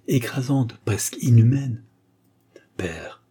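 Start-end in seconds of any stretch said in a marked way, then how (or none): 2.8-3.2 soft whisper of one word; murmured. Acted. Son murmuring to his stern father. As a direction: barely breathe it out. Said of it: "murmura-t-il".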